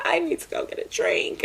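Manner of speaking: high, soft, breathy retold whisper; scene: Kiera wanting to leave after crying, imitated high whisper; weary whisper. Lightly imitated tired whisper. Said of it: "and she's like".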